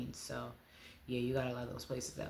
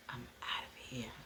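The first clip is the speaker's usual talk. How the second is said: whispered; 10 dB quieter than usual. Retold or imitated. Relief at escape from abuse.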